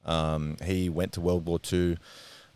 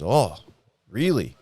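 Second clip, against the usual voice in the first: higher, louder retold "oh, really"; mildly acted surprise; 5 semitones higher, 5 dB louder, slower than usual. Nan hears granddad Blue spoke to her grandson, surprised. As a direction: imitate nan taken aback, pitch lifted. Nan reacting to startling news.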